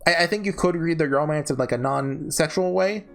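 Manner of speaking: usual speaking voice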